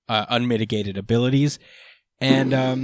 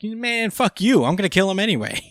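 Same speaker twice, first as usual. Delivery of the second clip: mock-defiant outburst, much higher; 7 semitones higher, 3 dB louder than usual. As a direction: brush them off and vow to kill. Defiant quoted threat.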